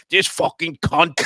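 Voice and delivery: slow, loud angry cursing; disgusted grumble at a neighbour; muttered curse. Retold or imitated. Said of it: "on the other side of my ear going".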